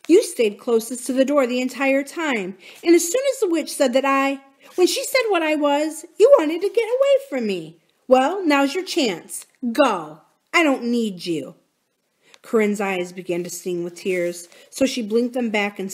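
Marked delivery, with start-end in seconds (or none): 0.1-11.5 hurt, angry accusation. Acted. Corinne tells Drew to leave, hurt and angry. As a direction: build the accusation, then cut the friend loose.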